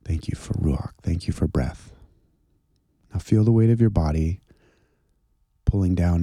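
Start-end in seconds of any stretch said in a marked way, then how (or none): 0.0-1.9 softer, breathed out on the exhale; gentle exhaled prayer. Shown as a demonstration. Leading listeners in prayer, softly breathed out. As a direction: let the thanks drift out gently on the breath. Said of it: "gently say that as you exhale".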